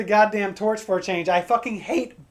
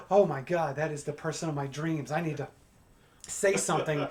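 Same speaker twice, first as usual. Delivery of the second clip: softer, lower breathless inner panic; smitten inner voice; 5 semitones lower, 9 dB quieter than usual. Pretend. Comic playing a smitten man on the street, soft and awed. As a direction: whisper to yourself, awestruck. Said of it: "your heart skips a beat".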